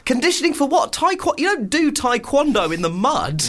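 high-pitched, disbelieving protest; incredulous. Real, not acted. Co-host questioning barefoot taekwondo training, incredulous. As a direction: challenge it in high, baffled disbelief.

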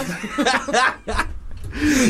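high-pitched cackling laugh; burst of laughter. Real, not acted. Co-host cracking up at the therapy answer, laughing.

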